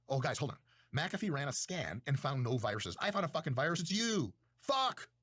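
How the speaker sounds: normal talking voice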